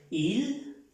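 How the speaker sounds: il with a bright i; il as a model; French il. Shown as a demonstration.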